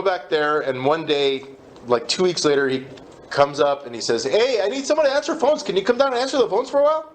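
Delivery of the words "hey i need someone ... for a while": sponsor's voice. Retold or imitated. Sponsor voiced in retold story.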